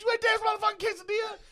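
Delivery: very high, loud mock disbelief; Keith Williams. Big guy confronting the quesadilla thief, hysterically amused. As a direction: squeal it in hammed-up amazement. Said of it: "he starts hysterically laughing".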